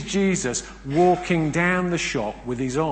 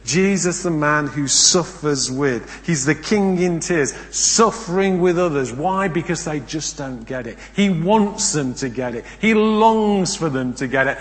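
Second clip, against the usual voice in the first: louder, rising, impassioned preaching; preaching at full force; 2 semitones higher, 7 dB louder than usual. Acted. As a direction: build it up like a pulpit climax.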